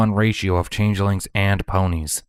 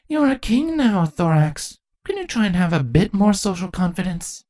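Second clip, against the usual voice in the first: higher voiced queen, dry and teasing; Chrysalis teasing; 12 semitones higher than usual. A narrator's reading. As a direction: needle him about his shyness.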